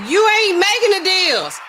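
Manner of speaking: family laying down the law, loud and high; mock family scolding. Family members scolding at intervention.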